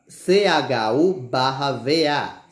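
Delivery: slow letter-by-letter spelling. Shown as a demonstration. Teacher spelling chuva slowly.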